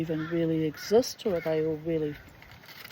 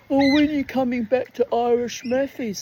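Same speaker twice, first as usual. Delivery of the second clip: mock Steph; 7 semitones higher, 7 dB louder than usual. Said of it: "He just looked at me".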